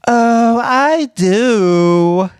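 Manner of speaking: put-on high, bright-eyed girly voice with a lift on each word. Asked by her co-host to show a girly voice, high girly put-on. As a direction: pop up into a breathy-free, sing-song girly register. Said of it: "asked you to put on a girly voice".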